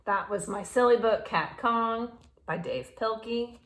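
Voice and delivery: ordinary delivery, nothing marked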